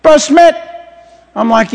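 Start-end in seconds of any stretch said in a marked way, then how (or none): 0.0-0.5 put-on high, raised boy's call; mock yell like an alarmed little boy; imitated boy's scream. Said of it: "the little boy screams".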